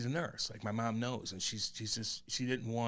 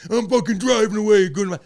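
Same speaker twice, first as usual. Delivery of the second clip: much higher and louder, yelling as he walks out; storming out; 10 semitones higher, 15 dB louder than usual. Acted. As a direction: shout it like you're slamming the door. Acted storming-out quote.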